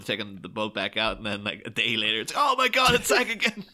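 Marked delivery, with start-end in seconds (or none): 2.4-3.6 high, louder hammed-up dismay breaking into laughter; mock alarm. Host retells the duck boat sinking, laughing mock alarm. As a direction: imitate panicked onlookers, laughing at the absurdity.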